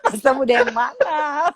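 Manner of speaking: teasing question broken by laughter; laughing tease. Real, not acted. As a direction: poke fun while laughing. Guest teases host, laughing. (menggoda sambil tertawa)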